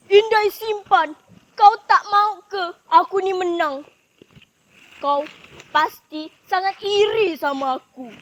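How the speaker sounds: loud, high wronged outburst; upset accusation. Acted. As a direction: rail at the rival who spoiled your win. Rival accuses tickler after losing.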